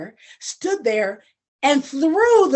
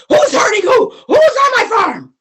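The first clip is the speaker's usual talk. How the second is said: high, loud furious yell; angry shout; 9 semitones higher, 9 dB louder than usual. Acted. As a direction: roar it out in fury. Farmer runs in angry.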